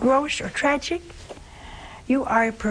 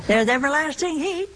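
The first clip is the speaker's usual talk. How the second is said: higher, lilting mock comfort; mock cheery reassurance; 5 semitones higher than usual. Speaker mockingly consoles someone bound for hell, mock cheery. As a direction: cheerfully pitch hell, higher, rising at the ends.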